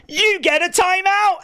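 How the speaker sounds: sudden high shout. Real, not acted. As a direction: yell it out like a verdict. Shouting timeout at chatter.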